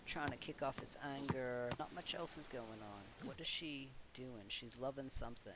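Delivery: ordinary talk, nothing marked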